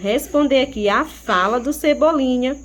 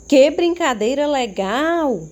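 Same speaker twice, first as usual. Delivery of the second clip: higher and louder, full of excitement; teacher demos an excited exclamation; enthusiastic exclamation; 4 semitones higher, 4 dB louder than usual. Shown as a demonstration. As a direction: read it like a thrilled child at play. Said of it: "Observaram como eu li a frase?".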